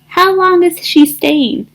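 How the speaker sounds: usual speaking voice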